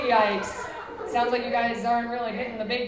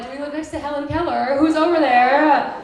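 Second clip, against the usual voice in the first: puzzled neighbors; 4 semitones higher, 8 dB louder than usual. Retold or imitated. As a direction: wonder aloud through the wall. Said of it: "My neighbors are like".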